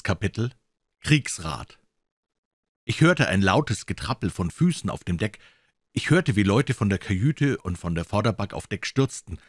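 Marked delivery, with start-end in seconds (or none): none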